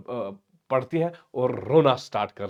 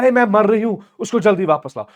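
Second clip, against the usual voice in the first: high, loud melodramatic cry; hammed-up desperate wail, starting high; acted shrieking complaint; 8 semitones higher, 7 dB louder than usual. Daughter dying to have suitor back, acted shrieking. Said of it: "अरे इस तरह मत चीखो".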